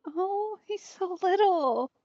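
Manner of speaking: ordinary delivery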